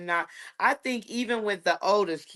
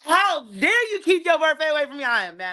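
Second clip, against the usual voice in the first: mock outrage; 9 semitones higher, faster than usual. Teasing a friend who kept her birthday secret, mock-outraged and laughing. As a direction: scold playfully, laughing through it.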